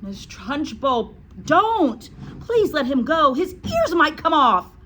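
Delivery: high pleading cry; desperate high pleading shout. A narrator's reading. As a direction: plead loudly for the boy. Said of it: "Miss Honey cried out".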